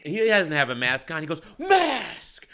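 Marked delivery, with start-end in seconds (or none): 1.6-2.5 high-pitched mock bark; retold shout. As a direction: call it out like an outraged bystander. Second acted customer confrontation.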